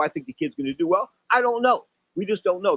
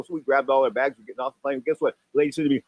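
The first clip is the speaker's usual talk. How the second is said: normal talking voice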